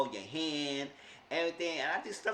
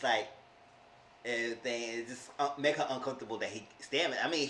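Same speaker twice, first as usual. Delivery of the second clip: ordinary talk, nothing marked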